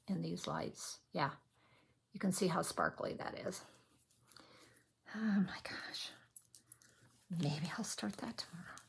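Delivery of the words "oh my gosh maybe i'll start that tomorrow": awed unvoiced whisper. Real, not acted. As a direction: hush it like a secret.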